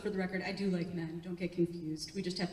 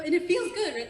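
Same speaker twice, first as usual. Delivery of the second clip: normal talking voice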